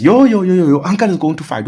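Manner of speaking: high, loud, fast alarmed cry of yo yo yo; alarmed warning. Retold or imitated. Uncle's wife warns the boy, high and panicky. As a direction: sound the alarm in a rush.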